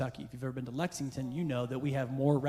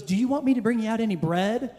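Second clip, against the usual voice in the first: high, loud perky waitress voice; bubbly server; 9 semitones higher, 10 dB louder than usual. Retold or imitated. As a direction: chirp it like an eager server. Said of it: "She's very bubbly".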